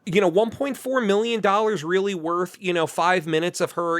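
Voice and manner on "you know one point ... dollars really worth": usual speaking voice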